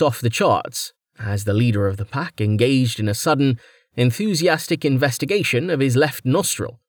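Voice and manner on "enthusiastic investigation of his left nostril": ordinary delivery, nothing marked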